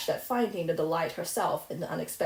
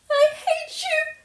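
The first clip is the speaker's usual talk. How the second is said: screamed high and loud, breaking into a sob; a child shrieking her hatred through tears; choked furious cry; 8 dB louder than usual. Acted. Orphan girl screaming at the rude neighbour, choked furious cry. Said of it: "she cried in a choked voice".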